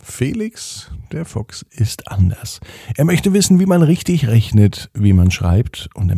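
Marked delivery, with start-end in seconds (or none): none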